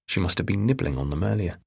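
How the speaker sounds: plain reading voice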